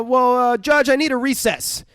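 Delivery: hammed-up courtroom appeal; flustered lawyer's plea. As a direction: pitch it up high, imitating a rattled lawyer. Acting out lawyer's plea to judge.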